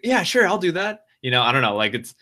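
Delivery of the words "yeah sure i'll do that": high, grudging inner critic voice; mock the doubting inner voice, pitched up; negative Nancy. Inner critic Nancy mocked, sarcastic and high. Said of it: "negative Nancy's like".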